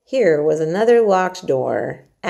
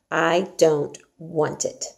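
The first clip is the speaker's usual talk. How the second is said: slow flat refusal; much slower than usual. Acted. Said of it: "repeated Mary".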